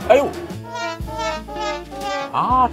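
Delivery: normal talking voice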